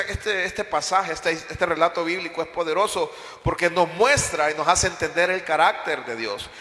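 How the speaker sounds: usual speaking voice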